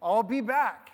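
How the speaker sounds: a hint of Austrian action-hero accent; mock Austrian accent, mildly done; Schwarzenegger accent. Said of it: "In his best Schwarzenegger accent".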